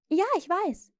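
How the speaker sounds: put-on small girl, matter-of-fact; little girl. A narrator's reading. Jane voiced.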